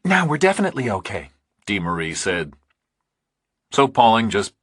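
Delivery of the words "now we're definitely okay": higher, fast and relieved; Dee Marie's voice. A narrator's reading. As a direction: rattle it off with a light woman's voice.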